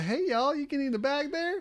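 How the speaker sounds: high-pitched country drawl of a shop cashier; country accent. Retold or imitated. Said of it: "the country accent".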